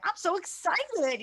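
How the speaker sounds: high, slow mock excitement; imitating forced excitement. Teacher imitating the student, mock excited. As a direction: stretch out a fake thrill. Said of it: "you were like".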